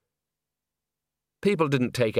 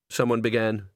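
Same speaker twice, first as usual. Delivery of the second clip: ordinary delivery, nothing marked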